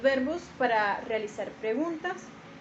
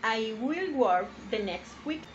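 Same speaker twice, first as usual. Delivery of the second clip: slow, clear "I will work the next week"; each word spaced, "will" lifted; slow example; 2 semitones lower than usual. Shown as a demonstration. Teacher reading the example slowly to the class.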